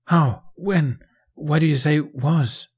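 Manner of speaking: high, loud bursts of disbelief; alarmed questioning. A narrator's reading. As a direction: demand answers in shock. Jack stunned, fires urgent questions.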